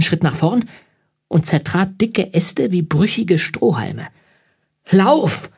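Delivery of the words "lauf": high, urgent yell with falling pitch; shouted warning. A narrator's reading. William to the Vogt as the monster looms, urgent shout. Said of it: "schrie William".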